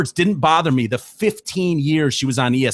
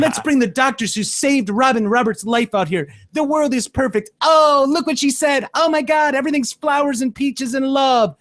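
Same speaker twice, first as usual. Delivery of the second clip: high, sing-out parody of delight; mocking gushy positivity; 11 semitones higher than usual. Viewer sending up morning show host's optimism, mocking gushy positivity. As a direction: gush with exaggerated wonder, climbing higher.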